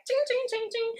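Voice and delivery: high, loud metallic ching noises; mock transformation sound. Fan imitating a Transformers transformation, high loud metallic chings. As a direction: mimic a robot blade slicing.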